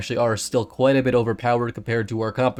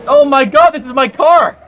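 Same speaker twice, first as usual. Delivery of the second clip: loud, high-pitched indignant cry; mock outrage; 11 semitones higher, 12 dB louder than usual. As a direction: yell in playful outrage over a wrecked car. Mock outrage at car being hit.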